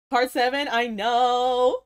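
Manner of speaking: normal talking voice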